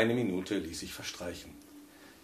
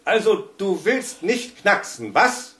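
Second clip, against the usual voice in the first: higher, louder, slow taunting dare; gloating challenge; 10 semitones higher, 10 dB louder, slower than usual. A narrator's reading. Husband taunts the silent radio, gloating challenge. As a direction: goad the radio mockingly. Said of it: "mit hämischer Herausforderung".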